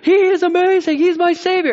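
over-the-top excited believer; gushing praise. Retold or imitated. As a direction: ham up a giddy worshipper singing Jesus's praises. Mock enthusiastic praise of Jesus.

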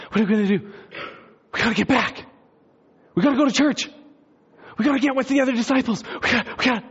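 high, hurried, building excitement; rally the others to rush back; frantic urgency. Acted. Disciples urgently deciding to go back.

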